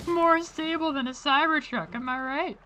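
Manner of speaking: high, mocking boast; high squeaky voice. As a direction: squeak it out like a joke.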